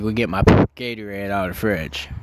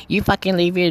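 much higher imitation of an angry woman; 8 semitones higher than usual. Mom confronting the storyteller, imitated woman voice. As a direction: pitch it up to mimic a woman. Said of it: "I'm gonna do a woman voice".

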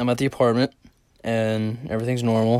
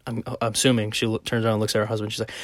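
usual speaking voice

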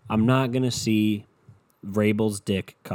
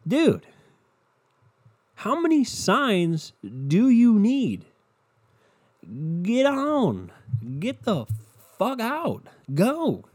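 much higher, yelling at them to leave; high, exasperated, get-out-already shout; pitched-up frustrated rant; 9 semitones higher, much slower than usual. Real, not acted. Ranting at Australians to leave.

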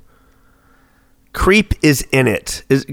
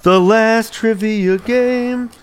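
slow, drawn-out hype voice, higher; dramatic announcer; 6 semitones higher, much slower than usual. Acted. Hosting final trivia for friend, slow dramatic announcer. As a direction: stretch each word like a game-show host.

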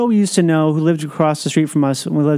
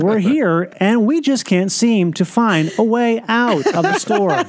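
slow, measured TV segment intro; newscaster voice; 4 semitones higher, slower than usual. Pretend. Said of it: "you talk like you're introducing a segment".

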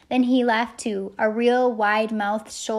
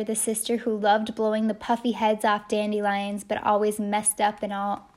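ordinary delivery, nothing marked

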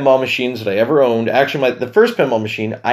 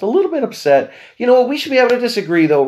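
squeaky mock protest; 11 semitones higher, faster than usual. High mock self-defensive tone.

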